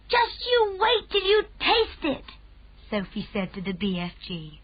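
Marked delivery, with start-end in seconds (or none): 0.1-2.2 put-on very high, thrilled child's voice; excited child. A narrator's reading. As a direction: promise it with delight. Sophie excited.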